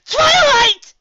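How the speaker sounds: overwhelmed reunion cry. Acted. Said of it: "he cried".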